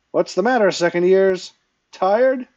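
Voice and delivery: loud, high teasing call; cheerful. A narrator's reading. Shang teacher teasing tired second years, cheerful. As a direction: ring it out as a cheery taunt. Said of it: "was improperly cheerful for a Yamani".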